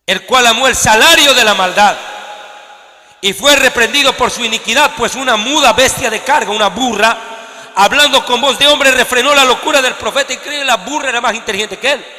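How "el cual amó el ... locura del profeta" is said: lectura enfática. Acted. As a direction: read the verse out with weight. Reads scripture with force. (lectura bíblica lenta y fuerte)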